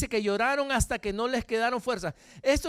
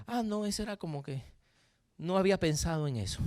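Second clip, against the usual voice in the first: low, subdued voice of a sad person; lightly acted glum reply, low and soft; sad meme person; 6 semitones lower, 5 dB quieter, faster than usual. Preacher voicing the sad person in a meme, mildly glum and low. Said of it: "sale una persona triste".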